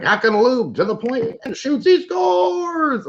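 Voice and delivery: very high-pitched hockey play-by-play call; Ed Whalen. Retold or imitated. Calling a Flames game on TV, hammed-up commentator impression. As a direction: build to an excited, ringing goal call. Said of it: "I can hear Ed Whalen talking about".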